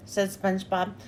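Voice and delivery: ordinary delivery, nothing marked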